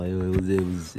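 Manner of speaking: normal talking voice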